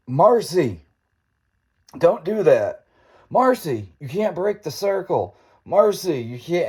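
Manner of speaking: high-pitched scolding yell at a friend; imitated exasperated group yell; mock yelling. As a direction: mimic kids yelling at someone to stop. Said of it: "keeps yelling at this poor girl".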